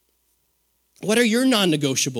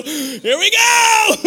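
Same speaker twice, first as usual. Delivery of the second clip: mock panic; 13 semitones higher, 6 dB louder, much slower than usual. Acts going under.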